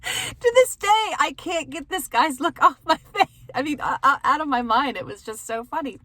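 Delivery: struggling to talk through the giggles; laughing. Real, not acted. Laughing at her own story.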